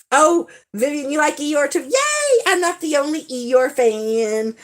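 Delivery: thrilled to find a fellow fan; delighted. Real, not acted. Greeting a viewer who also loves Eeyore, excited squeal.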